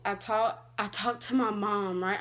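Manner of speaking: usual speaking voice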